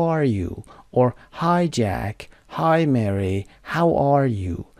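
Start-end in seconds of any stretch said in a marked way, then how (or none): none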